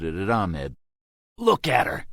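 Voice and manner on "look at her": loud, high shout. A narrator's reading. Soldier shouting at the prisoner, knife raised, loud and high. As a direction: yell it right in his face. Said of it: "Kevin shouted".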